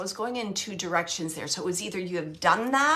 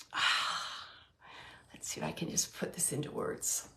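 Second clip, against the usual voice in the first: choked-up whisper; 7 semitones lower, 9 dB quieter than usual. Real, not acted. Medium choking up near tears.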